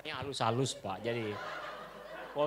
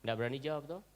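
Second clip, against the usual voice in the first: softer, cautious teasing voice; speaking softly; 2 semitones lower, 8 dB quieter than usual. Real, not acted. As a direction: say it quietly, as if to stay safe. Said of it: "ngomongnya pelan-pelan itu supaya saya aman". (bicara pelan-pelan supaya aman)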